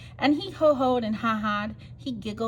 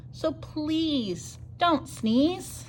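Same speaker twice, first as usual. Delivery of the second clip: drawn out high and slow plea; slow pleading; 6 semitones higher, much slower than usual. Acted. Hippo begging the elephant, slow pleading. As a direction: stretch the plea out slowly.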